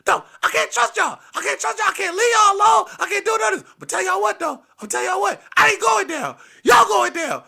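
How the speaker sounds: high, loud, frantic shouting; furious grandpa rant. Retold or imitated. Grandpa threatening to tell on the kids, high shouted rant. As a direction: shout in a strained high voice, piling up complaints.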